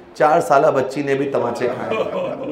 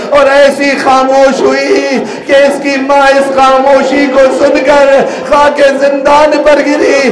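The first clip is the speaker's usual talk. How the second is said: preaching at full force; 9 semitones higher, 10 dB louder than usual. Acted. Preacher reciting the child's death to mourners, wailing. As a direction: build the grief until it breaks into cries. Majlis climax building to cries.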